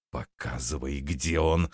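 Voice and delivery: low, quiet growl. A narrator's reading. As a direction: growl the order under your breath. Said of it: "прорычал Лоун". (рыча, тихо и низко)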